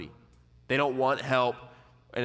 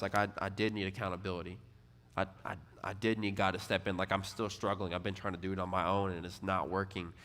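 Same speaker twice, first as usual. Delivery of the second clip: softer, lower, a touch of defeat; humble confession; 5 semitones lower, 7 dB quieter than usual. Retold or imitated. Young man quietly confessing to his pastor, subdued. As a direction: admit it quietly, worn down.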